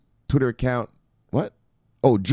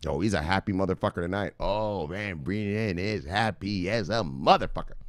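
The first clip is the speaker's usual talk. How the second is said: ordinary talk, nothing marked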